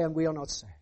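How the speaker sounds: soft, low, defeated; a hushed admission of defeat; quiet despair. Acted. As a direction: let it sink, hushed and lost. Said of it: "and then they say".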